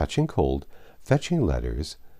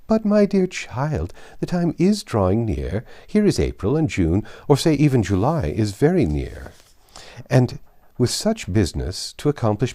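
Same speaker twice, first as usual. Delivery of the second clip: amused, bossy society lady; Mrs Elton; 5 semitones higher than usual. Acted. Matron laughing at a young woman's inexperience, condescending.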